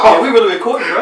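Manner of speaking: loud and teasing. Real, not acted.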